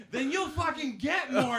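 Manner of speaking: a fired-up comic hitting back; hyped retort. Retold or imitated. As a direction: fire back, pumped up and laughing. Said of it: "I was like".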